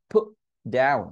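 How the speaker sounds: "put" with its T blocked, then "down"; stopped T. Shown as a demonstration. Teacher showing the class a blocked T, stopped T. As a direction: hold back the T in "put". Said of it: "টি উচ্চারণ করা থেকে আটকে দিয়েছি".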